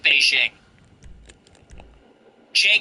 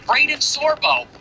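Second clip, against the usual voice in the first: ordinary delivery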